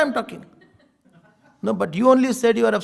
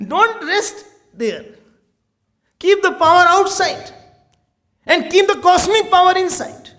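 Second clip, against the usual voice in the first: raised, forceful sermon voice; preaching; 7 semitones higher, 4 dB louder than usual. Acted. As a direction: preach it out to the hall.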